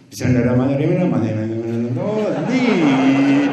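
garbled, hurried recitation of the prayer; mock rushed prayer. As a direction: mimic someone racing through the prayer. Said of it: "نمازای برقی".

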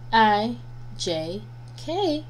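ordinary delivery

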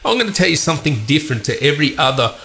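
normal talking voice

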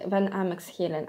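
ordinary talk

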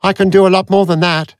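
a testy supercomputer waking up; irritable machine. A narrator's reading. Aurak snaps at Avon on waking, high and testy. Computer voice.

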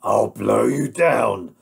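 loud, slow wolf threat; threatening wolf. Acted. Wolf answers the defiant pig, loud and threatening. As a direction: menace the pig as the big bad wolf.

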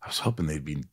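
hushed, unvoiced whisper. Host confiding about disappointing actors, hushed whisper. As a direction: whisper it like a guilty secret. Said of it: "people who you go".

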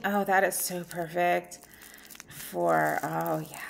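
normal talking voice

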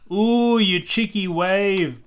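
high, loud, slow scolding of the wave; mock scolding. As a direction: tell off the naughty wave playfully. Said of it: "Bluey barks".

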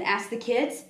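usual speaking voice

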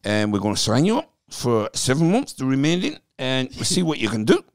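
loud, high-pitched Welsh manager impression; hammed-up Welsh voice; Welsh accent. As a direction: put on a big Welsh accent, loud and lilting. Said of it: "giving me my Welsh accent".

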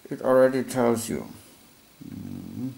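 talking with a pen in mouth. Real, not acted. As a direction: say it round a pen held in the mouth. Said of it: "with a pen in my mouth".

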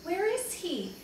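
high, concerned storybook question; worried question. A narrator's reading. Worried brother asks after lost boy.

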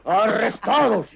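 loud furious sentence; angry shout. Acted. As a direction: shout the verdict. Colonel sentences deserters.

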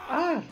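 sudden high yelp of fright; startled scream. Real, not acted. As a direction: jump and yelp in surprise. Startled by the game. (grito de susto)

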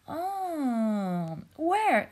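high, loud eager shout. Acted. Jellyfish shouts to sea star asking where, loud and high. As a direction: shout it out, desperate to know. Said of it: "Spencer shouted".

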